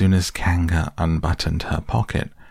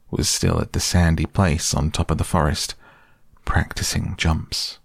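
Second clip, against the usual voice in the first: usual speaking voice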